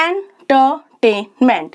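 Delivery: Entertainment in four distinct beats; syllable by syllable. Shown as a demonstration. Teacher showing four vowel sounds, entertainment split by syllable. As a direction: separate each syllable of entertainment. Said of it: "आपकी four sounds आ रही हैं".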